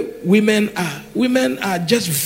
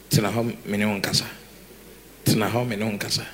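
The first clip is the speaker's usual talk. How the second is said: low, soft instructional boss voice; quiet mock command; 11 semitones lower, 10 dB quieter, faster than usual. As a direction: order them about softly, pitched low. Voices woman bossing men like sons.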